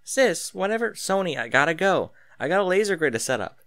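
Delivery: fast at the end, cutting the call short; suddenly rushed. A narrator's reading. Arya hurries off the phone to set up a laser grid, rushed. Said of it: "was Arya's suddenly rushed response".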